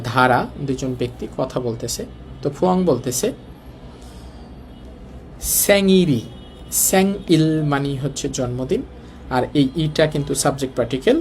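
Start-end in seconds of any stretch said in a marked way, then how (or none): 5.6-6.2 স্যাংইরি said as Korean saeng-i-ri, the r linking smoothly into the final i; Korean word demo. Shown as a demonstration. Teacher models স্যাংইরি for Bengali learners of Korean. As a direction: model স্যাংইরি clearly, rieul flowing into ri. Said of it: "রিউল উপরে গিয়ে হবে স্যাংইরি".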